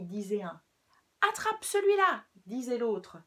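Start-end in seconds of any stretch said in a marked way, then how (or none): 1.3-2.3 high, loud taunting yell; fighting shout. Acted. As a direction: shout it while throwing. Rabbits fighting in story.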